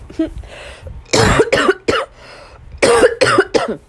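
real rough cough, sick with Corona; a loud, breathy coughing fit. Real, not acted. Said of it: "I'm getting over Corona".

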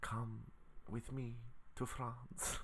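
put-on soft halting French accent; French accent attempt. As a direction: try out a French accent, drawn out. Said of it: "let me try to do a French accent".

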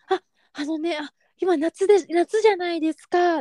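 polite, high-pitched staff explanation; shop-assistant voice. Retold or imitated. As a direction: imitate a clerk explaining to a customer. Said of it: "店員さんみたいな感じの".

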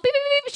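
high mock car warning beeps; imitating the car's collision warning beep. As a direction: chirp it like the Chevy's alarm.

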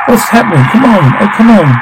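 high-pitched, frantic exclamations; frantic urging. Acted. Fleeing a killer and urging come on, frantic. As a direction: voice jumps up, urging desperately. Acted frantic escape scene.

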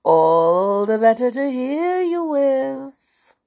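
loud, slow, rising menace; sinister wolf. Acted. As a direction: stretch it out like a scheming wolf. Wolf replies as granny.

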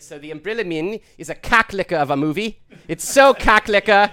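put-on South African accent drifting into Australian; attempted South African accent. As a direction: sell it in a badly faked South African accent. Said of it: "He sounds Australian".

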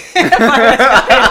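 loud burst of laughter; just crack up out loud. Real, not acted. (gargalhada alta)

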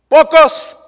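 high, loud cry of one word; sudden exclamation. Real, not acted. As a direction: burst out with it.

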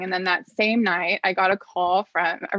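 ordinary talk

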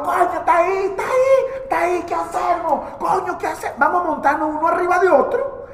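high, squeaky dwarf voices, urgent; little men whispering plans; high excited dwarf chatter; squeaky dwarves. Acted. Dwarves below plotting.

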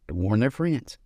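put-on old Southern grandpa, gently drawled; lightly voiced old grandfather. A narrator's reading. Old man voiced in story.

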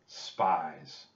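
usual speaking voice

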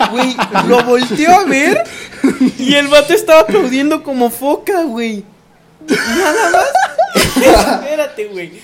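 real laughing fit, loud and high; cracking up, voice jumping high and loud; laughing while talking. Real, not acted. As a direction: struggle to tell it through laughter. Cracking up while telling story.

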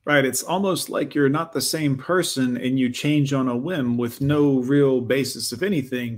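normal talking voice

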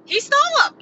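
high, put-on voice for the quote; mock outraged quote. Indignant quote to the camera, high and put-on. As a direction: squeak it out like an indignant victim. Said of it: "And I'm like".

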